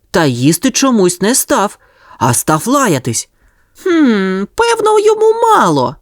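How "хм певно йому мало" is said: very high musing puppy voice; little dog thinking. A narrator's reading. Sonya decides the passer-by wants more, high voice. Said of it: "подумала Соня".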